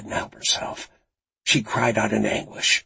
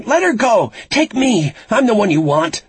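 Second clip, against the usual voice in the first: high, loud, desperate shouted plea; shouting; 12 semitones higher, 8 dB louder than usual. A narrator's reading. Frankie offers herself to the captor by phone, shouting. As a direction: shout urgently, offering yourself in exchange. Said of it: "she shouted into the phone".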